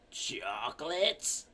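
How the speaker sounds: put-on soft, drawn-out old-lady question. Old lady puzzled at what they're selling, slow quiet old voice. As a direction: ask it slowly, low and frail. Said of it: "the very old lady comes in".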